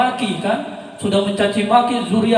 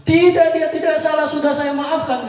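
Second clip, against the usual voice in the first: firm denial; 8 semitones higher, 4 dB louder than usual. Retold or imitated. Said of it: "kata Imam Ali".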